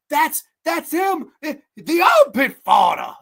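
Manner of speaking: hammed-up accusing cry; imitating the bear. Reviewer quotes the best line of the movie, put-on voice. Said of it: "They go".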